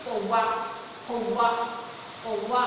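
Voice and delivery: "कौवा" with the au glide before va; demonstrated pronunciation. Shown as a demonstration. Teacher modelling au before va, demonstrated pronunciation. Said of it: "इस तरह से।".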